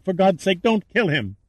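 high-pitched desperate plea; crying out. A narrator's reading. Captive begging for her friend's life, crying out. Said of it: "Lauren cried".

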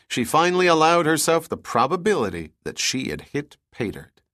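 ordinary delivery

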